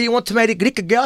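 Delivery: put-on high, quick, accented immigrant mother; Giuseppina, the mamma. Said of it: "That's Giuseppina". (mamma immigrata, voce acuta e domanda incalzante)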